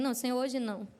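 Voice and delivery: hushed, half-hearted morning excuse; indisposed. Acted. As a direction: beg off quietly, as if not up to it. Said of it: "quando eu acordo indisposta".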